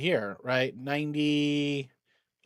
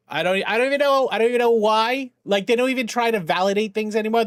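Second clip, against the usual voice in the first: exasperated rant; 6 semitones higher, 5 dB louder, faster than usual. Real, not acted. Ranting about the government.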